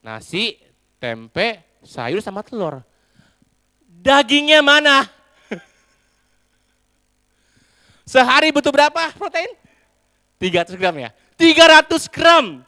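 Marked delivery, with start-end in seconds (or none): 3.9-9.6 angry demand. Acted. Husband demands the missing meat from his wife, loud and high. 11.4-12.6 high, loud insistent repeat. Acted. Husband repeats the protein demand to his wife, loud and high.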